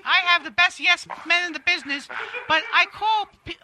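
self-satisfied celebrity impression, pitched above own voice; Kathy Griffin. Celebrity answering interviewer about herself, in a self-satisfied impression. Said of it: "Hey, Kathy".

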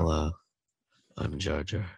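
hoarse sick-sounding voice; rough, under-the-weather voice. Pretend. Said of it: "because you've got sick voice".

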